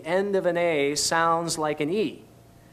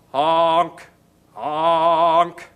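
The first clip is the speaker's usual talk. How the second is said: honking; 7 dB louder than usual. Shown as a demonstration. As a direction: honk it like a car horn. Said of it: "just like a car horn".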